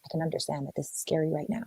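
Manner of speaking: soft, reassuring murmur; calm her fears gently; soothing partner. Retold or imitated. Loving man comforting a scared partner: soft, reassuring. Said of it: "true leadership is able to see".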